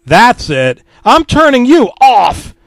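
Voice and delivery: fed up. A narrator's reading. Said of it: "I said".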